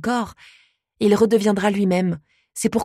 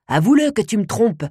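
shouting; 3 semitones higher than usual. A narrator's reading. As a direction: raise the voice, accusing. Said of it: "crie mon père".

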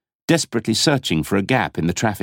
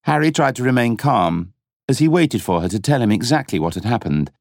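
usual speaking voice

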